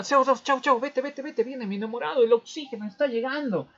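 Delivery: shooing away. Acted. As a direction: brush him off quickly, my sweetheart is coming. Hemoglobin shoos CO2 away.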